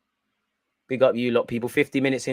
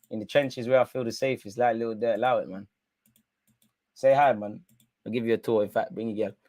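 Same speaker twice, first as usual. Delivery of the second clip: normal talking voice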